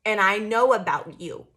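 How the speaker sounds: loud, pointed mock accusation; mocking accuser. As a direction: jab each line at them like a know-it-all. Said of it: "somebody tried to bring you a message and be like".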